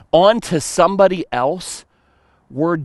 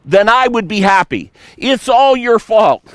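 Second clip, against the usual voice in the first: high, loud whining accusation; mock whiny blame; 8 semitones higher, 4 dB louder than usual. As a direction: whine and point the finger. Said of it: "Kind of like".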